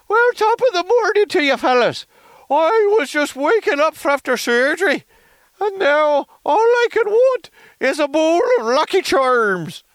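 very high, loud cartoon Irish voice; overdone Irish accent. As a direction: oversell a squealing Irish brogue. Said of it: "now he speaks with an Irish accent".

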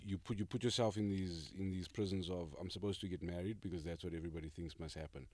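ordinary talk, nothing marked

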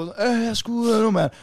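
slow, high-pitched plea to get in; impatient toilet-queuer. Retold or imitated. Boy banging on the toilet door, impatient. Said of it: "banker hårdt på".

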